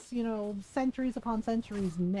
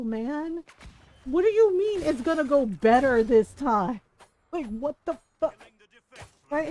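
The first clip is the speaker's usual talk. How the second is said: ordinary talk, nothing marked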